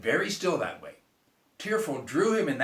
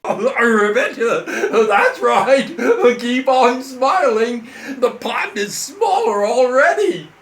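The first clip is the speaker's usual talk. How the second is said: loud, higher, dramatic frog complaint; lamenting frog; 8 semitones higher, 13 dB louder, slower than usual. Acted. Frog complaining to the girl, loud and dramatic. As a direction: wail it like a theatrical frog.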